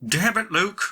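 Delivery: high-pitched mock scolding; Uncle Owen exasperated. Uncle finding Luke drugged out in the desert, mock exasperated. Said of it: "Uncle Owen comes walking up, he's like".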